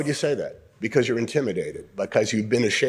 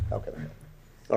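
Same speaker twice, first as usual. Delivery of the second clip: whispered line; 2 semitones lower, 9 dB quieter than usual. Shown as a demonstration. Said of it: "Was it".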